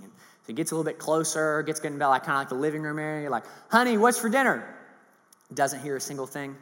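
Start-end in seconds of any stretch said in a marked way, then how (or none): 3.7-4.8 raised call, higher and louder; husband shouting to his wife from the door. Retold or imitated. Husband calling again closer.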